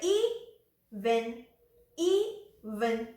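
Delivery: "ई", pause, "वन", each syllable alone; teacher slowly splitting "ई, वन"; broken into syllables. Shown as a demonstration. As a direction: separate long "ई" from "वन". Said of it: "जैसे ये ई से शुरू हो रहा है".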